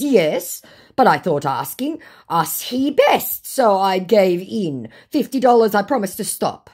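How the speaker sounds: put-on pleading, stretched out; scammer voice. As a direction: act out the scammer's halting excuse. Voicing the scammer's excuse.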